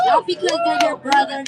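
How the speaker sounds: rally-cry emphasis on the first words; emphatic rising shout. Real, not acted. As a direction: punch NOT, high and loud.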